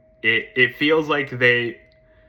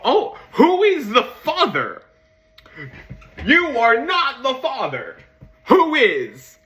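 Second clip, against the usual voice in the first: high, loud mock-dramatic demand; hammed-up outcry; 12 semitones higher, 6 dB louder than usual. Fan demanding to know Rumi's father, hammed-up outcry. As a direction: proclaim it like an overblown paternity reveal. Hammed-up paternity reveal over plot hole.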